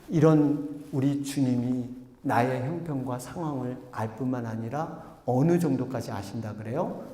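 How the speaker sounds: ordinary talk, nothing marked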